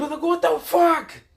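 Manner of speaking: slow, high, loud disbelief on fuck; stunned outrage. Real, not acted. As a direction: drag out the fuck in shocked disbelief. Narrator reacting to unstoppable flames.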